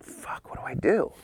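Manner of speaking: hushed, breathless panic; panicked whisper. Retold or imitated. Panicked after being fired.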